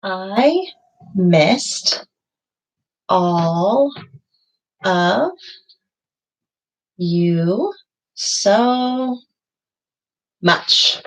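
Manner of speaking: slow, one word at a time, as if writing each word. Shown as a demonstration. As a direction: spell out the phrase slowly for the class. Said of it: "So let's fill that in".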